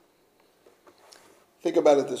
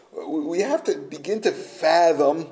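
usual speaking voice

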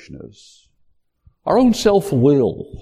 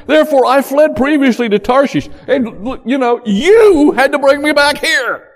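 high, loud indignant complaint to God; frustrated prayer; 10 semitones higher, 4 dB louder than usual. Acted. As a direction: accuse God, exasperated. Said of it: "this is his prayer".

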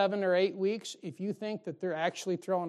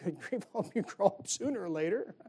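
weary exasperation. Retold or imitated. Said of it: "You're like".

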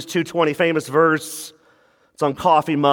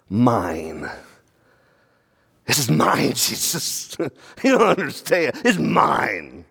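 possessive grumble; 6 semitones lower, slower than usual. Pretend. Voicing possessive believer to Jesus.

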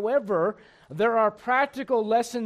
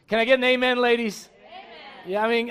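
ordinary talk, nothing marked

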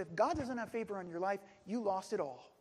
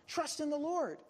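raised, higher-pitched exhortation; urging; 6 semitones higher than usual. Retold or imitated. David exhorting his companions around him, urging. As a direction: urge them on with lifted pitch. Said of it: "he's told his companions around him".

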